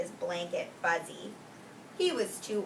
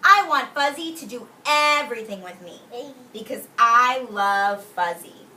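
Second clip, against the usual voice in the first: Owen's thoughts; 8 dB louder than usual. Retold or imitated. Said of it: "I bet he's thinking".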